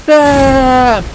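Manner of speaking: high, loud, long-held name; mock an anguished drawn-out shout; Frodo, melodramatic cry. Fan mocking movie Frodo calling his friend, melodramatic shout. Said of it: "And Frodo's like".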